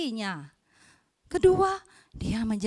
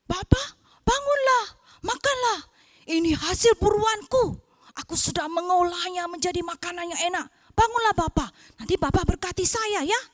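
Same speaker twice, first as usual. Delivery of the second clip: higher, eager son urging his father; coaxing son; 4 semitones higher, 3 dB louder, faster than usual. Acted. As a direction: wake him up and tempt him with the food. Son coaxes father to eat.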